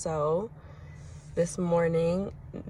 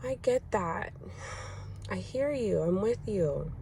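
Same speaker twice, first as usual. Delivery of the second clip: softer, higher, gentle comfort; soft reassuring empathy; 4 semitones higher, 4 dB quieter than usual. Retold or imitated. Mom comforting her four-year-old, soft and tender. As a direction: soothe him quietly while he calms. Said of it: "And I'm like".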